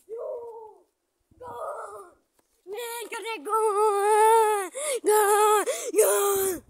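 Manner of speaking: high, loud triumphant shouts; excited goal shout. Real, not acted. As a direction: yell the goal with joy. Celebrating scored goal.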